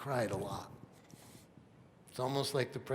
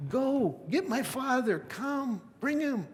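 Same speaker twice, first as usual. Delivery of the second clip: much higher, urgent and excited; eager command; 11 semitones higher, slower than usual. Acted. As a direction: send them off in joyful haste. Joseph sends brothers for father.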